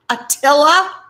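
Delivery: can't believe that name; incredulous coworkers. Retold or imitated. Coworkers hearing the friend's name, mock disbelief. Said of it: "And they're like".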